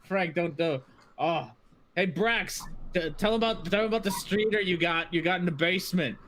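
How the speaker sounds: high cackling dwarf teasing; mocking dwarf. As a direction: tease the guests to your buddy. Dwarves mocking, laughing.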